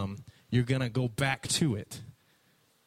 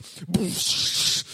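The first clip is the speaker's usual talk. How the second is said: explosive whooshing "boosh"; imitated splash; 4 semitones higher, 3 dB louder than usual. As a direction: make the sound of a huge splash. Imitates fish bursting from the water.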